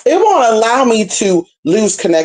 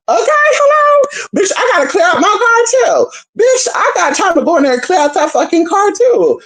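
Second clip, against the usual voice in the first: worked-up rant. Real, not acted. Ranting to viewers about chores, high cracking yell.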